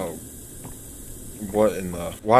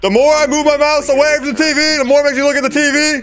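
building from loud to much higher panicked shout; rising panic; 6 dB louder than usual. Real, not acted. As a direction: complain louder and louder. Panics, game forces view.

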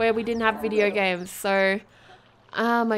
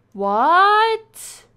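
higher, drawn-out baffled questioning; puzzled, rising exclamation; confused; 4 semitones higher, 5 dB louder than usual. Real, not acted. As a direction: ask it in bewildered disbelief. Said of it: "I'm very confused".

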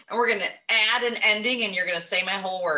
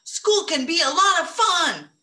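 excited; 7 semitones higher than usual. Shown as a demonstration. Teacher repeating the excited sentence for the kids, excited. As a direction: say it like the best news ever. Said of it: "With an exclamation point at the end, 'cause you're excited".

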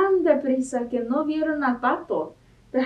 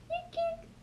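a tiny, very high, much softer "Kik, kik"; mouse squeak; 14 dB quieter than usual. Acted. Mouse answering Duck with tiny high squeaks. Animal noises in picture book.

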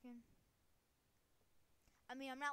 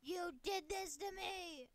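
loud, higher, slow drawn-out accusation; mock accusing yell; 4 semitones higher, 7 dB louder than usual. Player blaming the minions, melodramatic yell. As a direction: cry out the blame melodramatically.

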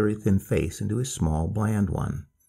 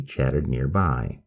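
ordinary delivery, nothing marked

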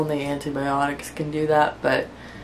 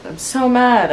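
mad, pitched up sharply; frustrated whine; 7 semitones higher than usual. Real, not acted. Venting to her viewers, a high frustrated whine. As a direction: let the frustration jump up high. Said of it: "I'm just so mad".

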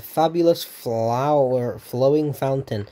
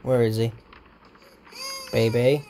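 ordinary delivery

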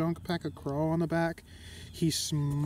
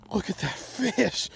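excited awe; 4 semitones higher, 3 dB louder than usual. Real, not acted. Admiring the big fish.

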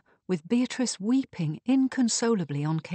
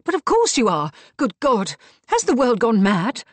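loud, high-pitched dismay; acted, exasperated horror; appalled outburst; 5 semitones higher, 8 dB louder than usual. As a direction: exclaim as shock sinks in. Shocked outburst at news of murder.

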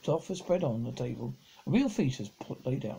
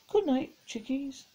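high, slow motherly goodnight; tender mother; 11 semitones higher than usual. A narrator's reading. Mother bidding her children goodnight, high and slow. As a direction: tuck the children in with the voice.

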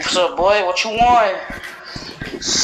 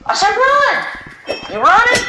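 frantic yell. Real, not acted. Player yells at fleeing teammate, frantic. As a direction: yell the order at them.

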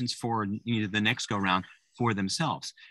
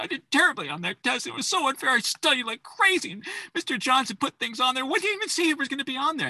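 put-on high, whiny voice of an upset teen; upset kid. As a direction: rant like a kid who thinks the test was unfair. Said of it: "bursts into tears and says".

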